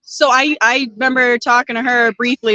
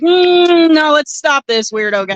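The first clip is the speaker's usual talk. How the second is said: firm no; 5 semitones higher, 3 dB louder, slower than usual. Retold or imitated. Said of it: "And you're like".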